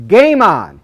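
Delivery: pumped-up game on. Shown as a demonstration. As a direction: shout it like a starting whistle.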